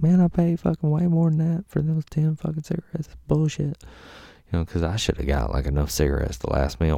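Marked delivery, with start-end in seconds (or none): 0.0-3.8 indignant complaint. Retold or imitated. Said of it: "I was like".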